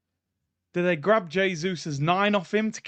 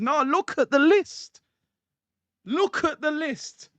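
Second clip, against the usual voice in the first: higher, slow and emphatic, pointing at the list; excited Berta; 9 semitones higher, slower than usual. Retold or imitated. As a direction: push him to look at the list, slow and high.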